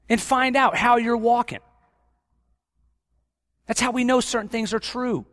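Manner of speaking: loud, rising exhortation; impassioned preaching. Acted. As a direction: urge the church on with fire. Preaching at full force.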